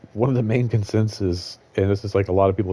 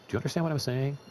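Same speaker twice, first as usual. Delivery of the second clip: pitched-up scolding question, rising at the end; talking down to a kid; 8 semitones higher, 3 dB quieter, faster than usual. Retold or imitated. Scolding a guy who kept crossing the line, like a child. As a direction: talk to him like a twelve-year-old. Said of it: "like he was, like, a twelve-year-old".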